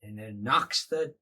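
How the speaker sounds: ordinary delivery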